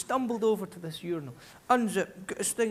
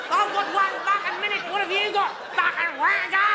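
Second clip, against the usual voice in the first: furious high-pitched shouting, much higher and louder; drunken pub rage; 5 dB louder than usual. Retold or imitated. Drunk in a Brisbane pub screaming at the barman over last orders, furious shout. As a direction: scream abuse like a drunk who has just heard last orders. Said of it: "You go mental".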